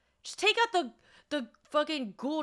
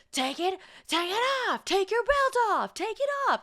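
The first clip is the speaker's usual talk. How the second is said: much higher mock order; imitating the guard; 11 semitones higher than usual. TSA agent yelling at grandpa to take off belt, mocked. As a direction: repeat the guard's demand, rising.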